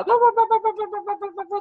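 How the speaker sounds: very high rapid ba-ba-ba; telegraph-like tongues. Shown as a demonstration. Preacher likens tongues to a telegraph, high repeated syllable. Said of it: "打电报".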